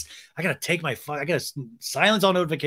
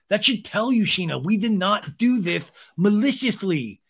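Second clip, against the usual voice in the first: high, louder lispy protest; Sandoval; 7 semitones higher, 4 dB louder than usual. Retold or imitated. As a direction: insist on innocence in a mocking lisp. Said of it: "Sandoval's like".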